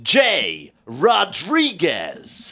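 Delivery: long, drawn-out slow announcer call. Shown as a demonstration. Host duelling guest in American accents, booming slow announcer. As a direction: stretch each word like a movie voiceover. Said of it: "we're gonna announce them".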